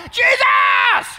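very high, wild yell; crazed shouting. Acted. Blind man going crazy for Jesus, shouting. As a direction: scream the name out like a madman. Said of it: "going crazy, shouting".

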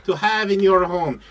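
hammed-up foreign pitchman selling a box; broken-English pitch for a mystery box; mock foreign accent.

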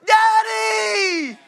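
high, loud, crying cry of daddy. Acted. Preacher voicing a child crying out to God as daddy. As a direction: wail it like a frightened child. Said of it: "one of his children go".

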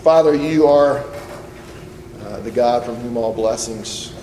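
soft, unhurried pastoral prayer; gentle closing prayer from the pulpit. Acted. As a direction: pray quietly over the congregation.

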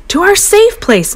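high panicked screech; mother screeching in fright. A narrator's reading. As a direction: shriek the escape plan. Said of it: "Mom screeched".

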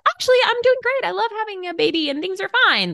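high, bright fake-cheerful voice, fast on the second line. A tired new mom pretending to be fine, high fake cheer.

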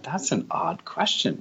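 a quietly bemused thought to himself; baffled inner thought. Retold or imitated. American newcomer hearing Australians' question, mildly baffled.

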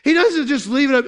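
normal talking voice

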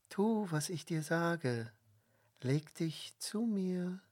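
hushed, coaxing command; urging seduction. A narrator's reading. Wife holds Josef fast and urges him, hushed. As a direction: softly press him to give in. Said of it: "redete auf ihn ein".

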